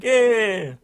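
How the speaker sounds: high, loud, drawn-out whoop; hammed-up celebratory yell; mock triumphant cheer. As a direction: cheer like a delighted oblivious hero. Voicing the hero's cheer.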